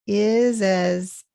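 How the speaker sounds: IS AS joined as one, iz-az; linked words. Shown as a demonstration. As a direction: run the Z of IS into AS. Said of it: "this is linked here".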